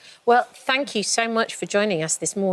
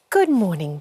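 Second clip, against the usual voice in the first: usual speaking voice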